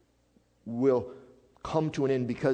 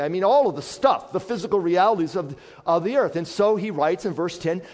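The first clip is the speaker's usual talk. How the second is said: loud, raised preacher's voice; impassioned preaching; 5 semitones higher, 8 dB louder than usual. Real, not acted. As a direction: press it on the congregation, high and forceful. Preaches and reads at full force.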